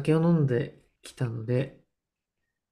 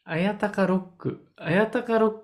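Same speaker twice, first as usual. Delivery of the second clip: high, baffled repeat; incredulous; 8 semitones higher than usual. Real, not acted. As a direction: repeat it in disbelief.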